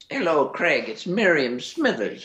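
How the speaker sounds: put-on high, drawn-out old lady voice; Miriam Smithers. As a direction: play a comic old woman phoning in, slow and swooping. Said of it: "there's a character called Miriam".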